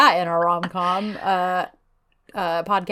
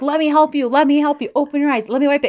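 raised, insistent offers; urgent helping; 5 semitones higher, faster than usual. Retold or imitated. Man offering to wipe her eye, acted urgently. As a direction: repeat the offer in a mild fluster.

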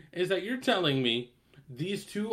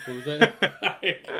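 laughing; 4 semitones higher, 4 dB louder, much slower than usual. Retold or imitated. Friend laughs, he turns.

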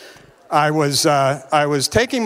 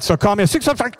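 rushed number again; 2 semitones higher, faster than usual. Retold or imitated. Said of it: "Go ahead".

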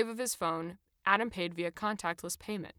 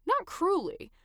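usual speaking voice

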